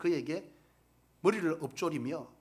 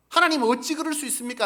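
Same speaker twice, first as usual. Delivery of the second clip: higher and much louder cry of 하나님 어찌; loud protest to God; 8 semitones higher, 11 dB louder than usual. Retold or imitated. Preacher acting believers crying out to God, loud protest. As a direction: hurl the question at God, loud. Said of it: "라고 하지만".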